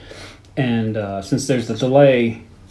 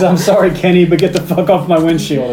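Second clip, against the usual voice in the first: higher, louder acted-out outburst; mock yelling; 5 semitones higher, 5 dB louder than usual. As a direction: call it out like an exasperated driver, hammed up. Acting out her yell at Kenny.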